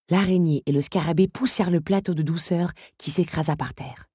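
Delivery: ordinary delivery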